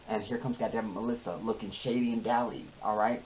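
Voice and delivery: usual speaking voice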